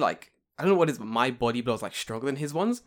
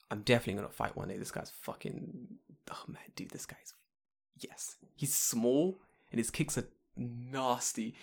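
whispering; 6 dB quieter than usual. Real, not acted. Hushed gossip about classmate.

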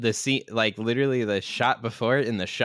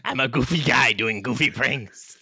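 silly villain voice; 3 semitones higher than usual. Pretend. Continuing the Invisible Man bit.

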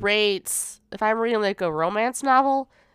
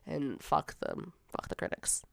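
slow, soft breathy whisper; 6 semitones lower, 13 dB quieter, slower than usual. Real, not acted. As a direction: mutter it under your breath. Muttered end of critic rant.

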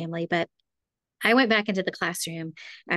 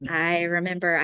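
normal talking voice

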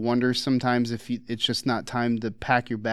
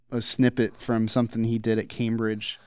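ordinary talk, nothing marked

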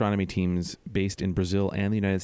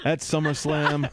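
The Rock impression; 6 semitones higher, slower than usual. Shown as a demonstration. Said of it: "That's my rock impression".

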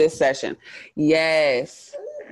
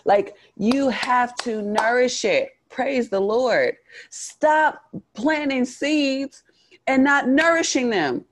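preaching; 10 semitones higher than usual. Acted.

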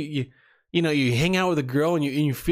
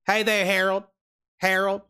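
Southern accent; 4 semitones higher than usual. Shown as a demonstration. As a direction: test a Southern voice, a bit higher. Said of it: "Should I do the Southern accent?".

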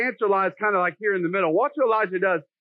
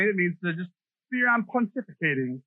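ordinary talk, nothing marked

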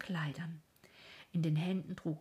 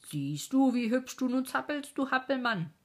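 higher, louder, sneering taunt; mocking nightmare; 7 semitones higher, 6 dB louder than usual. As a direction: gloat over the twitching man.

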